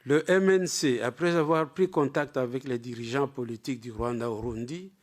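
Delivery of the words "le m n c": ordinary talk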